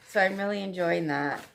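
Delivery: ordinary delivery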